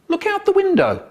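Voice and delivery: very high, sudden call; urgent diversion. A narrator's reading. Narrator distracts inspector from the rats, high urgent call.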